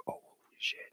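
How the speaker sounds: barely-there whisper; hushed crowd reaction, almost no voice; stunned hush. Retold or imitated. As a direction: breathe it out in frozen disbelief.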